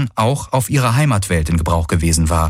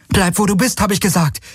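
high, tense frightened warning; scared shout; 11 semitones higher than usual. A narrator's reading. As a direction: insist through fear. Repeating her warning, frightened.

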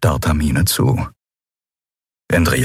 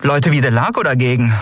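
higher-pitched heroine, mildly disgusted; lightly voiced captain, higher than the narration; captain venting to her crew; 8 semitones higher than usual. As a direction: confide a grievance to friends.

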